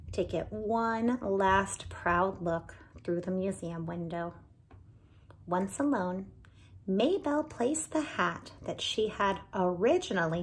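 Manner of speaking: put-on lively read-aloud for children; animated storyteller voicing each character; story narration. A narrator's reading. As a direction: tell it like a bedtime storybook. Reading picture book aloud.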